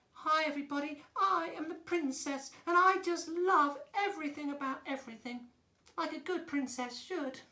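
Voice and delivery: high, sweet princess; young princess voice. Shown as a demonstration. Princess greeting everybody and loving everything, high girlish voice. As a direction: gush like a young princess, pitched well up. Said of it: "She talks like this".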